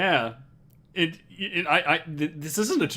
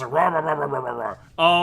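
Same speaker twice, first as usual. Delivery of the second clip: rampaging monster noises; 4 dB louder than usual. Retold or imitated. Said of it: "Let's just say that he goes".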